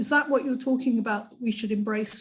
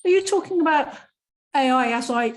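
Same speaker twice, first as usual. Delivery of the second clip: confused interruption; 5 semitones higher, 5 dB louder, faster than usual. Real, not acted. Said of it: "I'm a bit confused".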